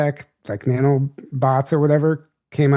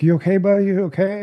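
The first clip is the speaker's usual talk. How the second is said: put-on comforting a child; 6 semitones higher, faster than usual. Comforting a crying little boy.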